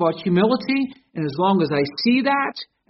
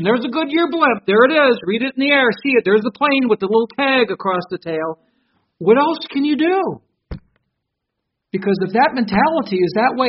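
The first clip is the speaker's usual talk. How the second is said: ordinary talk, nothing marked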